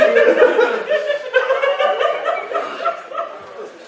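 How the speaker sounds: long, high, loud cackling; real screaming laughter. Real, not acted. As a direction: crack up completely. Group laughs at story.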